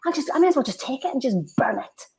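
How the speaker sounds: hammed-up sarcastic scold; mock sarcastic mother. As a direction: imitate a mother guilt-tripping her kid about money. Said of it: "mother shouting at her daughter".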